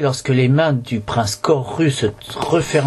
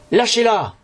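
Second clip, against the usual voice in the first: mild command; 10 semitones higher than usual. A narrator's reading. Boy orders the mummy to release Nila, mild high call. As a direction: narrator calls out a light order.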